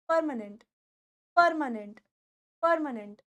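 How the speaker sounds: permanent said carefully, repeated; correct pronunciation. Shown as a demonstration. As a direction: drill permanent for the class. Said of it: "this word is not permanent". (permanent का सही उच्चारण)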